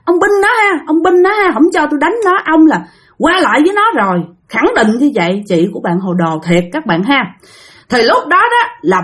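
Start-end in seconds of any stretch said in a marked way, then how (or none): 0.0-4.2 high, furious yelling; jealous accusation. Retold or imitated. Girlfriend yelling at her boyfriend for taking her sister's side. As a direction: hurl the blame at him. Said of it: "nói rằng á là".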